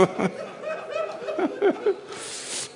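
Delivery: a sudden high sobbing wail; high sob breaking out mid-sermon. Real, not acted. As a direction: break into a high keening cry. Wail after the quoted line.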